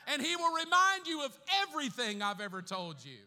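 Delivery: loud, high preaching shout; fiery revival preacher; preaching at full force. Real, not acted. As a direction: lift the whole room with it.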